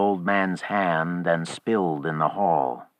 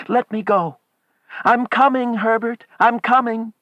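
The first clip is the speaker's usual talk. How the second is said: high, loud, eager cries; frantic calling out; 6 dB louder than usual. A narrator's reading. Wife calls to son at the door, frantic cries. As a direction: call out desperately, pitched very high.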